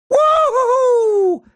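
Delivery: high, drawn-out woo-hoo; long whoop. Acted. Hero whoops mid-adventure, high and drawn out.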